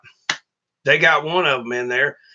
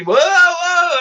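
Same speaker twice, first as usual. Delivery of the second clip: much higher and louder startled whoa; alarmed farmer; 4 dB louder than usual. Retold or imitated. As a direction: imitate the farmer startled by the steam. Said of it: "and he".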